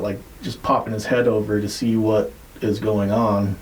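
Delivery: ordinary delivery, nothing marked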